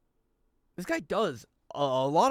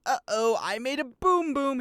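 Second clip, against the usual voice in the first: high, loud, drawn-out cartoon voice; Brett impression; 10 semitones higher, 6 dB louder, slower than usual. Streamer mocking the movie guy he hated, high goofy voice. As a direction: exaggerate the goofy movie character's catchphrase. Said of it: "It was like Brett. He's like".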